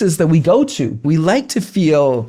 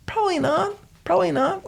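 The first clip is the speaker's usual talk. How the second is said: high quiet aside; 13 semitones higher, 7 dB quieter, faster than usual. Shown as a demonstration. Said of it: "answering own question".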